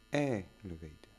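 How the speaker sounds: "Elevator." stressed EL-evator; first-syllable stress. Shown as a demonstration. Teacher showing stress from Roald Dahl words, EL-evator stressed first. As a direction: punch EL in "Elevator.". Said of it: "l'accent est sur la première syllabe".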